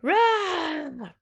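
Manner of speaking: high, sharp growling bark; a short bear snarl. Acted. Bear growling his answer about the latkes. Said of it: "Old Bear growled".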